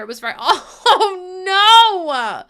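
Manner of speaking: high, loud laughing wail, slowed; laughing cry. Real, not acted. Streamer overwhelmed by chat's kindness, laughing.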